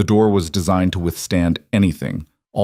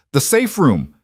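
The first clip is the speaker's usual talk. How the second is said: shouting; 6 semitones higher than usual. A narrator's reading. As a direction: call it out over gunfire. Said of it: "Marco shouted".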